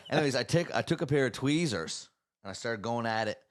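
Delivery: ordinary talk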